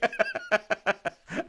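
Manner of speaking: loud, high burst of laughter; laughing. Real, not acted. Comic cracking up at a covid-day greeting, laughing.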